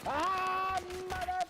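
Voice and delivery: very high, loud cut-off curse; frustrated yell. Real, not acted. Player losing the firefight, high frustrated yell. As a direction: cry out as you lose the fight.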